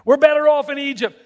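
hammed-up, high-pitched complaint; mock wailing. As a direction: wail it like a whining crowd. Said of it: "when you wailed".

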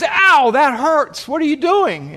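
high, drawn-out cry of pain and protest; pained yelp. Acted. Biker protesting to paramedic tightening the tourniquet, pained yelp.

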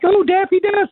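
very high mock-excited woman; lady mimicked. Lady mistaking the duck, mimicked in a very high voice. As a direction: gush in a falsetto fan voice. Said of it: "And she's like".

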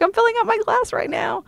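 very high, eager reply; can't wait, already doing it; giddy rush. Retold or imitated. Rushing to retest.

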